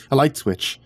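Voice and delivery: plain reading voice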